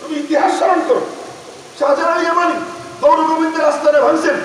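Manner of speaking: high-pitched, forceful sermon questioning; impassioned preacher's exhortation; fervent preaching challenge. Acted. Challenges the congregation.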